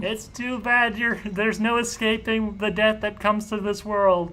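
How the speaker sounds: high, slow sneering farewell; mocking villain. Villain sneering at the fleeing girl, high and slow. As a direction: sneer it with fake pity.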